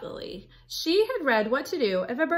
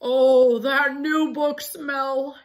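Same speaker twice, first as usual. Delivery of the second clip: loud oh, then slow savouring voice; rapturous wolf; 3 semitones higher, 7 dB louder, slower than usual. Acted. Wolf sniffing Red's book, slow rapturous swoon. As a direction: swoon over the smell.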